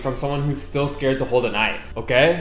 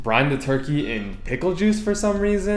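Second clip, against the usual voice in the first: ordinary delivery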